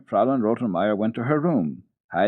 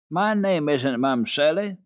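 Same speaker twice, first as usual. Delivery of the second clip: a little vexed; 5 semitones higher, 4 dB louder, slower than usual. Acted. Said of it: "a little vexed in her turn".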